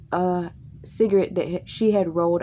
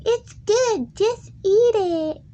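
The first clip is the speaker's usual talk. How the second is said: very high, louder, slow put-on granny; bossy grandma; 5 dB louder, slower than usual. Grandma ordering the kid to eat, high loud granny voice. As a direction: screech it like a pushy old lady. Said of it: "she's like".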